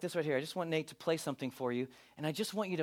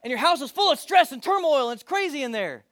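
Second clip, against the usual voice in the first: excited; 8 semitones higher, 13 dB louder than usual. Real, not acted. Excited build about chaotic home.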